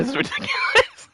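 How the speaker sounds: high laughing yell; laughing shout. Real, not acted. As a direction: shriek it through laughter.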